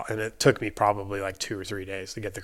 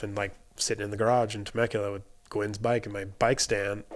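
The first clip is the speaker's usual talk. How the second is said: ordinary talk, nothing marked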